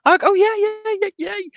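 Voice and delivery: high, excited run of yeahs; excited cheer. Real, not acted. Celebrating a contact, cheering.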